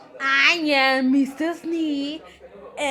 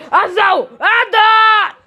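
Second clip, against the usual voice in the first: high, loud shout; 9 semitones higher, 9 dB louder, slower than usual. Pretend. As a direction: yell it out at full voice. Child's sudden outburst in story.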